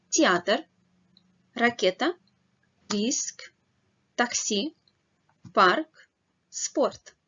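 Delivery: each word said crisply for learners to repeat; clear Russian drill. Shown as a demonstration. Teacher leading learners through words, clear drill. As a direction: model every word clearly with pauses to repeat. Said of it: "Remember to read the words with me".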